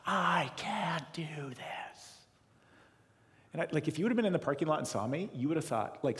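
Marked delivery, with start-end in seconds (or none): 0.1-2.1 slow, breathy despair; strained whisper. Retold or imitated. Yelling at the steering wheel, retold as a slow whisper. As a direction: whisper it as if broken. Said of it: "I was yelling at my steering wheel".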